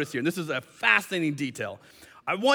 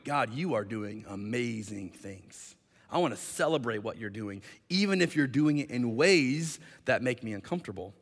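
hushed, humble worship; reverent prayer; 6 semitones lower, 7 dB quieter than usual. Acted. Preacher models praying to God instead of complaining, reverent.

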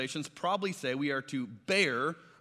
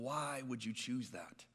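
mild weeping plea; 5 semitones lower, 11 dB quieter than usual. Retold or imitated. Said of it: "Jesus is sitting there weeping".

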